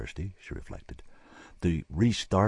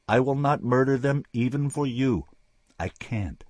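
firm refusal; 7 semitones higher, 6 dB louder than usual. Acted. Man refuses her firmly.

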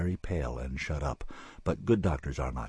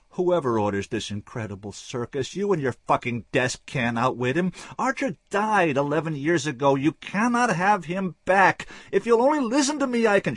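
rising higher and louder, desperate; urgent pleading man; 11 semitones higher, 7 dB louder than usual. Acted. Bleeding Dimsdale pleading with Rebecca, growing louder. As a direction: push harder with every phrase.

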